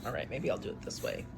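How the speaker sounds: plain reading voice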